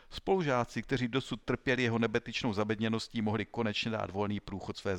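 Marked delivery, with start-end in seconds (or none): none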